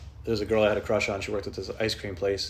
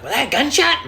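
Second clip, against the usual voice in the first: alarmed; 9 dB louder than usual. Retold or imitated. Alarmed at a bang.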